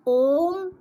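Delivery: ओम held long over three beats; prolonged Om. Shown as a demonstration. Teacher demonstrates the three-beat vowel in ओम, held long. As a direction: sustain ओम like a chant. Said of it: "जैसे हम लिखते हैं ओम".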